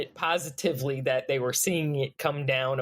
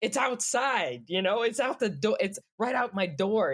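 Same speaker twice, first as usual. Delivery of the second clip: mock protest; 7 semitones higher than usual. Voicing Australians with debris at the door, in mock protest. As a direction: insist in pitched-up pretend disbelief.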